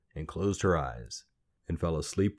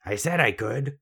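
higher, irritated little man voice; croaky snap; 6 semitones higher than usual. A narrator's reading. Little man to the doubting girl, impatient snap. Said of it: "the little man snapped".

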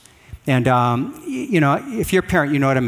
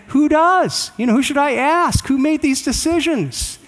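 sarcastic parent; 10 semitones higher than usual. Retold or imitated. Parent mocking child's answer.

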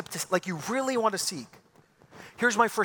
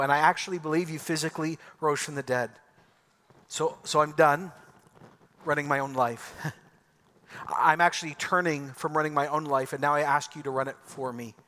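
leading prayer; 4 semitones lower than usual. Acted. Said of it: "then you pray this right now".

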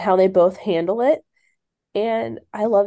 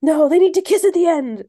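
mock insistent author; 7 semitones higher, 4 dB louder than usual. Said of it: "would have been tempted to be like".

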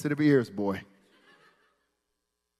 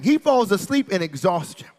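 usual speaking voice

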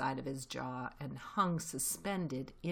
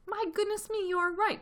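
much higher, louder surprised exclamation; delighted cry; 6 dB louder than usual. Acted. Mr Fox praising his thoughtful son, delighted high exclamation. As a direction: burst out in delight. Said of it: "cried Mister Fox".

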